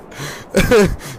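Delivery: loud breathy laugh; hearty laugh. Real, not acted. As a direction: let the laugh roll out. Laughing after the joke.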